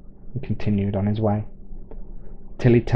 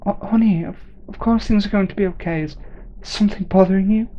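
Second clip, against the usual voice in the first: much higher, quick fussing mum; worried mother; 10 semitones higher than usual. Acted. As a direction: rush to reassure like an anxious mother. Mother voiced in the story.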